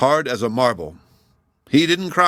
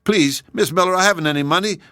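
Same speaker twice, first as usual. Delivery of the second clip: very politely; 7 semitones higher than usual. A narrator's reading. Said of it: "Dickie was saying very politely".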